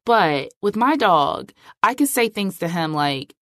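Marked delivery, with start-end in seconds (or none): none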